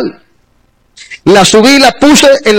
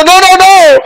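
frantic insistence; 5 semitones higher, slower than usual. Retold or imitated. Running down, shouting to sisters.

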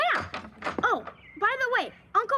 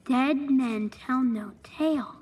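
ominous reading; 7 semitones lower, slower than usual. Acted.

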